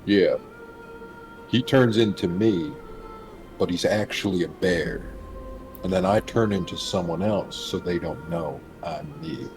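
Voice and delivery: ordinary delivery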